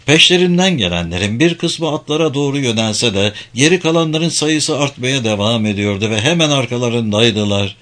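ordinary delivery

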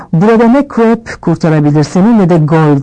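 ordinary delivery

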